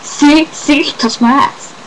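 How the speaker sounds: a touch of triumphant tattling; insistent. Retold or imitated. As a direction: insist as if proven right. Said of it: "And I'm like".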